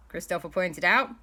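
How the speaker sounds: ordinary delivery, nothing marked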